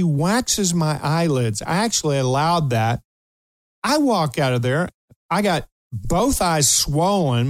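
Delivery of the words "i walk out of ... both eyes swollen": usual speaking voice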